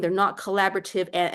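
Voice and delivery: ordinary delivery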